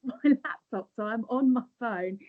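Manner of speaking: pushing volume up for a bad line. Real, not acted. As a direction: speak up so the call carries. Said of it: "can you hear me now".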